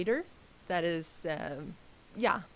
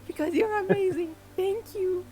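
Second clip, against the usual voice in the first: gushing; 11 semitones higher, 3 dB louder than usual. Real, not acted. Gushing over a chatter.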